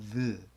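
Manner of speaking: the said with the tongue between the teeth; th sound demo. Shown as a demonstration. Teacher showing students the English th sound, tongue between teeth. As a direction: bite the tongue lightly and say the. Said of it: "윗입술과 아랫입술을 이빨 사이에 끼고".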